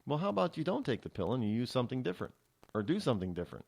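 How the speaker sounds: ordinary talk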